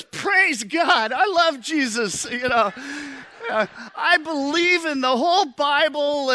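very high, loud mock-fervent waiter; gushing religious zeal. Waiter learns diners are pastors, gushing with sudden zeal. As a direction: imitate a waiter suddenly overflowing with piety.

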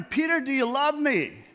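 a hint of Jesus pleading; Jesus asking. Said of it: "You know".

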